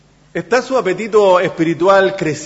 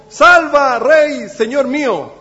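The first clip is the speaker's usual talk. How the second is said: loud, high cry for help to the king; pleading shout; 7 semitones higher, 6 dB louder, much slower than usual. Retold or imitated. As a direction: call out desperately as the woman begging. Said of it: "una mujer le gritó". (grito de súplica, fuerte y agudo)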